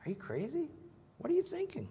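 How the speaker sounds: voicing Paul as if the idea is crazy; incredulous Paul. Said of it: "Again, Paul says".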